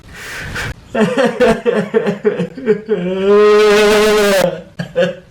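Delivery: a big long burst of laughter; loud laughing fit. Real, not acted.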